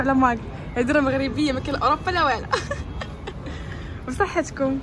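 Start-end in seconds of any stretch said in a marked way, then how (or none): 2.5-4.0 high, soft giggle; quiet high laugh after teasing. Real, not acted. (ضحكة خفيفة)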